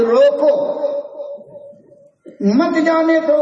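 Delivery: "روکو" rising, long pause, high "مت جانے دو"; pause after روکو. Acted. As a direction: set "روکو" apart, then raise "مت جانے دو". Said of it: "میرا مطلب یہ تھا".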